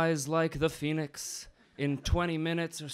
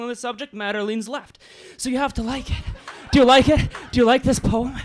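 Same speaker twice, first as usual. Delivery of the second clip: higher, louder, fast and breathless; frantic mock passion; 8 semitones higher, 8 dB louder, faster than usual. Slam poet hamming up a climax for the crowd. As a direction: push faster and faster, demanding approval.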